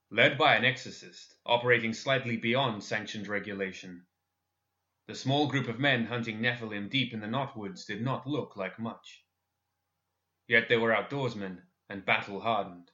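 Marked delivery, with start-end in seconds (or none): none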